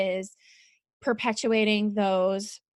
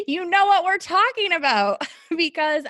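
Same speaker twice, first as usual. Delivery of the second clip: higher, louder laughing words; 8 semitones higher, 5 dB louder than usual. Real, not acted. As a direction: laugh while you say it. Laughing about misread email.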